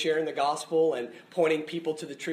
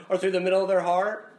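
ordinary delivery

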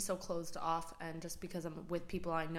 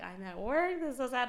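louder, rising mock whine; retold crying; 5 semitones higher, 5 dB louder than usual. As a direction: complain as if tearful, pitch climbing. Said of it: "and I cried".

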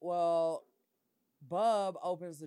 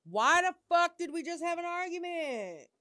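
exasperated; 12 semitones higher, 6 dB louder than usual. Real, not acted. Exasperated rant.

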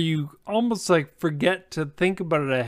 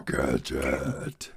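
mock villain; 8 dB quieter than usual. Echoing the villain line.

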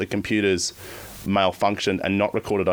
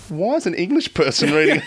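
mock confused caller; 8 semitones higher than usual. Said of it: "They would have gone".

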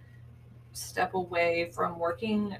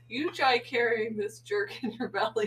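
ordinary talk, nothing marked

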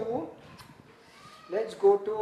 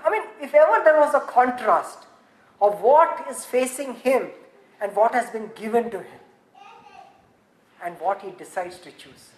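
ordinary delivery

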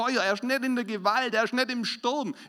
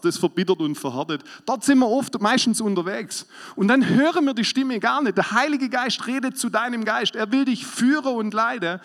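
preaching at full force; 2 semitones higher, 6 dB louder than usual. Acted. Preacher warning the congregation about the soul, full-force preaching.

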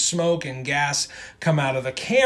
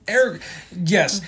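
ordinary talk

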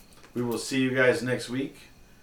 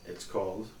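normal talking voice

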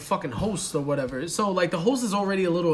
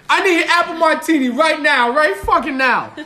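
loud, higher, pushy order; loud mock entitled demand; 8 semitones higher, 9 dB louder than usual. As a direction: demand it like a spoiled brat. Repeating his rude demand.

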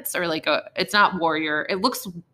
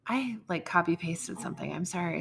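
ordinary talk

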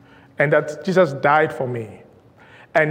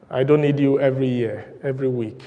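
usual speaking voice

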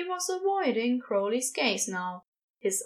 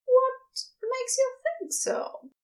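high, slow, curious question; Ezra, probing; 13 semitones higher, slower than usual. A narrator's reading. Ezra probes Crowley about being the somebody, curiously. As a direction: draw the question out in a much higher voice.

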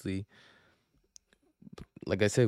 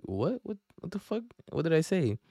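high, squeaky disbelief on "what the fuck"; incredulous high squeak; retold shock; 8 semitones higher than usual. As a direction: act stunned at the news, pitched right up. Shocked at being blamed.

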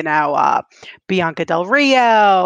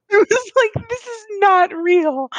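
laughing in disbelief; 12 semitones higher than usual. Real, not acted.

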